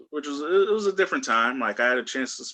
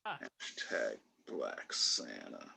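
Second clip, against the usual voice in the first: announcer-style tag line, low and drawn out; deep slow tagline; 13 semitones lower, 14 dB quieter, much slower than usual. Acted. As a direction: drop the voice low and slow for the catchphrase.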